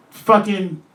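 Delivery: usual speaking voice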